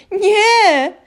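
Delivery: high, loud panicked cry; waking elf shrieks in terror; shrill scream. A narrator's reading. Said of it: "krzyknął".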